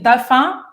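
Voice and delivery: "Tá fã" as a quick two-syllable question; contracted casual French. Emílio talking casually to the learner, "Tá fã" contracted. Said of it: "o Emílio falava assim comigo".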